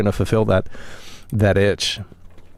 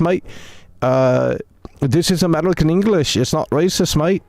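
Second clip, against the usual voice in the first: exaggerated mock Aussie-British accent; fake British-Australian accent; 4 semitones higher than usual. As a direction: lay on a made-up Brit-Aussie accent. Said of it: "it's not even a real accent".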